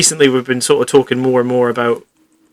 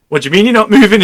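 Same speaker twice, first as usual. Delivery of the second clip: incredulous shout; 7 semitones higher, 6 dB louder, faster than usual. Retold or imitated. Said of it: "was just like".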